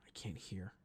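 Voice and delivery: hushed whisper to herself. Retold or imitated. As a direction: whisper it under your breath. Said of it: "whispering while eavesdropping".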